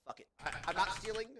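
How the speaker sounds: ordinary delivery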